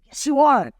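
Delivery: high and loud, firm insistence; insistent friend. Retold or imitated. Friend loudly insisting she is a star. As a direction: push back hard on her doubt.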